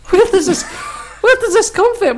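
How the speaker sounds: high, laughter-broken question; laughing incredulously. Real, not acted. Friend baffled by the flying giraffe plan, laughing incredulously. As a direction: struggle through laughter to ask.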